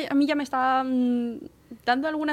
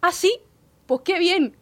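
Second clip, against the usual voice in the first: high, loud 'oh really' brightness; clueless polite reaction; 8 semitones higher, 6 dB louder, slower than usual. Retold or imitated. Employee unimpressed by famous actors, cluelessly cheery. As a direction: chirp it high, none the wiser. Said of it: "yo me quedo así, hago".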